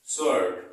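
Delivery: स्वर्ड said as "sord", no W; स्वर्ड with the W left silent. Shown as a demonstration. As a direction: say स्वर्ड loudly, S straight into the vowel. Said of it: "इसमें भी डब्ल्यू silent है".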